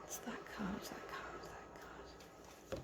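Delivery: breathy fading whisper. Real, not acted. Insistent whispered card pick.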